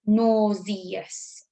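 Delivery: "Nauseous" said as NAW-zee-us, the e sounded out; "Nauseous" in three syllables, the e heard. Shown as a demonstration. Teacher sounding out the spelling word for pupils, NAW-zee-us. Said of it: "Hear it now".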